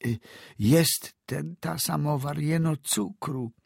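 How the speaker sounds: put-on high-pitched, halting old woman's voice; frightened old woman. A narrator's reading. Frightened old woman answers doctor.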